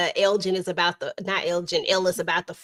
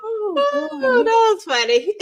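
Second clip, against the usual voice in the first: talking through laughter; high, laughing while speaking; 5 dB louder, much slower than usual. Real, not acted. Laughing after a joke.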